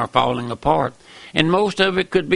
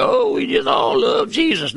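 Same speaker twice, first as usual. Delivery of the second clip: mocking falsetto; 12 semitones higher than usual. Preacher mocking smug churchgoers, high mock shout. Mocking what people claim.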